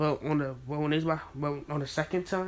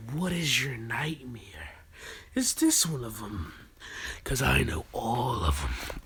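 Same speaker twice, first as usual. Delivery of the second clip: whispered taunt; 3 semitones lower than usual. Acted. Naruto taunting the crying villagers in a low whisper.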